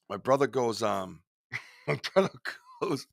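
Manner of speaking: ordinary delivery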